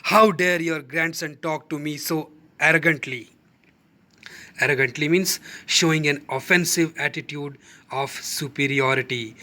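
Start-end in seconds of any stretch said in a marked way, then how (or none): none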